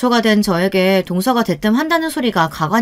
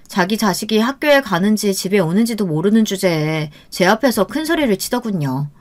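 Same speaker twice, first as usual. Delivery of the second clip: plain reading voice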